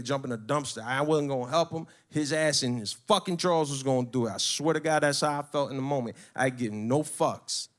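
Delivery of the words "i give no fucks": plain reading voice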